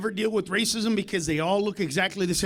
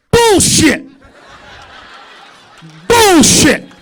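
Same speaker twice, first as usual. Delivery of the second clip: shouting bullshit; 8 semitones higher, 11 dB louder, much slower than usual. Acted. Shouting down the heckler.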